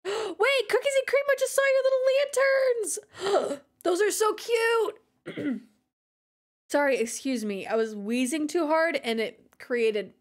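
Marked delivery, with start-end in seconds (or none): 0.0-5.6 much higher, gushing over cute lanterns; thrilled high gush; excited gasp. Real, not acted. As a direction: squeal with delight.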